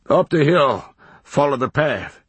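rustic and emphatic; hermit giving directions. A narrator's reading. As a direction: call out the way like a hermit.

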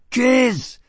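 high loud call; shout. A narrator's reading. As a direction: yell her name.

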